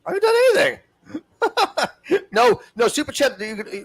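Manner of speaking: high laughing denial; laughing protest. Real, not acted. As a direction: squeal it out through laughter. Defensive high squeal, laughing.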